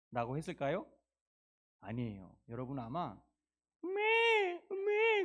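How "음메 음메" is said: very high, crying baa; sheep bleating. Acted. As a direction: bleat like a weeping lamb. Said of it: "그랬을 거예요".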